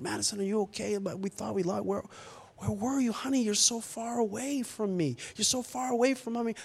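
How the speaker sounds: soft, hushed, worried tenderness; tender parental concern. Retold or imitated. Father hugging his found daughter, soft tender concern. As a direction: gently check on a found child, very softly.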